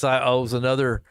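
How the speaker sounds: ordinary delivery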